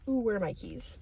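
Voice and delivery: ordinary talk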